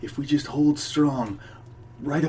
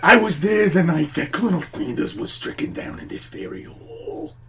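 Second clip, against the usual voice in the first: straining; 6 semitones higher than usual. Acted. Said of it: "It sounds like you're straining really hard when you speak".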